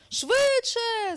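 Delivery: high, loud drawn-out urging shout; urgent command. Acted. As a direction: call out to hurry the runner on. Bear urging hedgehog to run.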